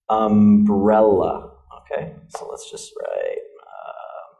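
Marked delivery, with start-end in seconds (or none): 0.1-1.5 slow "umbrella", weight on "brel"; stressed second syllable. Shown as a demonstration. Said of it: "this is the stressed syllable".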